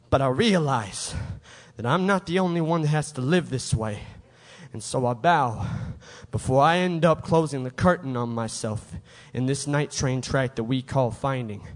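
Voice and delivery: low, softened closing of a poem; subdued resignation. Acted. Poet bowing out after an outburst, subdued. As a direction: drop low and quiet, pausing between phrases.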